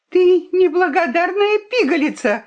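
high, loud furious cry; the fox shouting at the ungrateful girl; angry shout. Acted. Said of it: "крикнула лиса".

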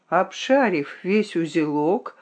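plain reading voice

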